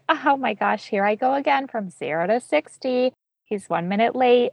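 host mocking her own panic over a late partner. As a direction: ham up the overreaction, self-aware. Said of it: "laughing at yourself and being like".

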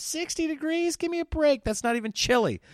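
scoffing. Retold or imitated. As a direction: scoff at the idea of being cold. Mocking how harmless sixty sounds.

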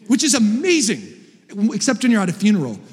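normal talking voice

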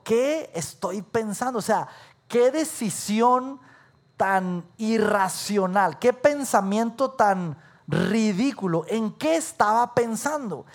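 slow, drawn-out words of disbelief; exasperated self-reproach. Retold or imitated. Scolding himself in the awful motel room, exasperated. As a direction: stretch each phrase in mock dismay. Berates own decision in motel.

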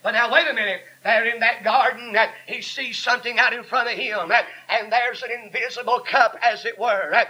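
full-force sermon with gasped breaks between phrases; fervent preaching. Real, not acted. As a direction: drive each phrase home to the congregation.

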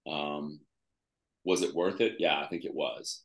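normal talking voice

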